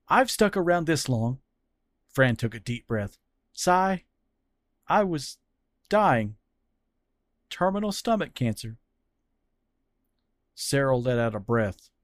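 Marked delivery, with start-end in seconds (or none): 0.1-1.4 put-on higher, heartfelt young man pleading; Fran's earnest voice. Fran confessing his love to Cyril, earnest. 3.5-8.8 higher, hushed heavy admission; Fran's grave confession. Acted. Fran revealing his terminal cancer to Cyril, grave.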